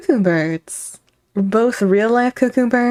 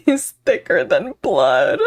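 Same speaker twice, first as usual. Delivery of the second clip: laughing; 5 semitones higher than usual. Acted. Mock dramatic reaction, laughing.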